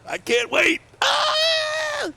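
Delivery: high excited yell; excited shout. Real, not acted. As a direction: squeal with real excitement. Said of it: "I'm actually excited".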